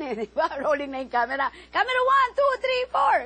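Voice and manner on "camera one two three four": high, loud counting; countdown call. Retold or imitated. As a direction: call the count across the set. Countdown on set.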